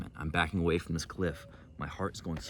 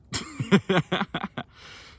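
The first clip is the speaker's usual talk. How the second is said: laughs; 13 semitones higher, 6 dB louder than usual. Real, not acted. Laughing at breakup.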